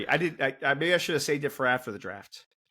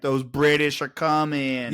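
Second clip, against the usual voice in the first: slow, drawn-out Boston townie; unhurried mock Boston accent; slower than usual. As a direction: imitate a Boston local, stretching the words. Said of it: "guarantee he was like".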